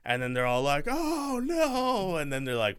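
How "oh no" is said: squealed high "Oh, no"; hammed-up gasp of horror; mock horror. Characters' horrified reaction.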